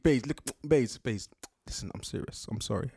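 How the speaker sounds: sinking into a low, hushed apology; somber pleading. Acted. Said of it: "he looks so somber".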